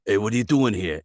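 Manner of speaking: a light New York accent, gruff and quick. Shown as a demonstration. Minotaur audition line in a light New York accent. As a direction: tough New Yorker challenging a stranger. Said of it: "some sort of weird New Yorkish accent".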